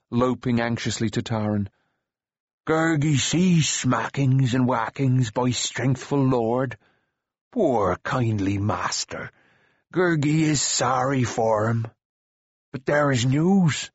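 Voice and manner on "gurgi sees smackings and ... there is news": slow, sorry creature voice; doleful creature. Acted. Gurgi pities his poor master, doleful creature voice. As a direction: draw the words out like a pitying creature.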